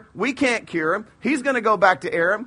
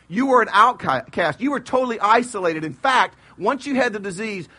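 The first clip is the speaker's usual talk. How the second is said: ordinary talk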